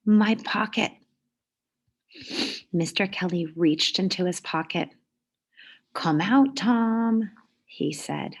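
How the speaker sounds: lively storybook read-aloud; reading to children. A narrator's reading. Teacher reading a picture book to the class, lively. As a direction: read it like story time for kids.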